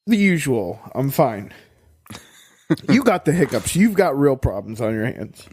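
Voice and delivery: ordinary talk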